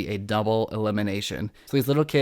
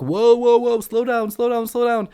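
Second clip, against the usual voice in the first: calling out; 11 semitones higher, 6 dB louder than usual. Retold or imitated. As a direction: shout it up high like a judge stopping him. Judges calling out to fast walker.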